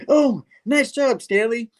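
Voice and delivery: slow, high mock praise; high praising Spidey. Spider-Man praising the goose, slow and high. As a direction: draw it out high like praising a pet.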